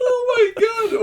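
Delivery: high laugh-squeak. Real, not acted. Player laughing at the mangled fingers. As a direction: gasp it through laughter.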